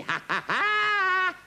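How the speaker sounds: very high, drawn-out mad cackle; villainous laugh. A narrator's reading. King gloating over his coming revenge, cackling. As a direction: cackle slowly, stretching the last ha.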